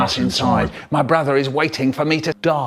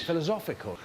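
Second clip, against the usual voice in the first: ordinary delivery, nothing marked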